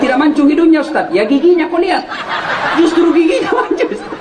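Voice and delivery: giggly congregant reply; laughing reply. Retold or imitated. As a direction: answer the preacher while laughing. Congregant answering preacher, joking.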